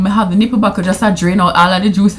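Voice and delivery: ordinary delivery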